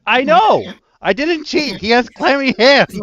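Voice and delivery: high, loud re-enacted schoolkid protest; indignant outburst. Accused child insisting to teacher and classmates, exasperated shout. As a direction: cry out your innocence at top pitch.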